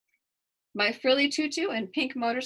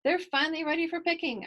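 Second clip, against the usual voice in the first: put-on high, excited little-girl squeal; bright child's squeal of joy; squealing with delight; 8 semitones higher, 4 dB louder than usual. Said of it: "I squeal".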